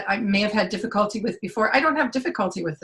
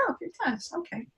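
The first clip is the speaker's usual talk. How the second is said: soft, higher, quietly amazed; hushed surprise; 7 semitones higher, 12 dB quieter than usual. Retold or imitated. Client quietly realizing the clearing actually helped, soft surprise. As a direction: murmur it like a pleased discovery.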